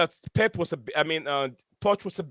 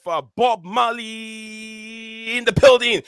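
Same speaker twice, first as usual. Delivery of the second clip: drawn-out, higher announcer call; big slow welcome to the show; hype intro; 5 semitones higher, slower than usual. Real, not acted. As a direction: stretch the words out like a ring announcer. Host hypes guest's arrival.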